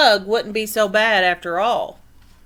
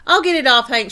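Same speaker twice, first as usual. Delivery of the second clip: tumbleweed shouting to Hank; shouted; 2 semitones higher, 7 dB louder, faster than usual. Acted. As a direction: shout it out as you leap to help. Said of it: "she shouted".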